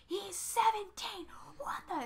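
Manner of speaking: retold parental outburst without extra volume; mock freak-out. Dad reacts to the boyfriend news, mock freak-out. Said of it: "And freaks out".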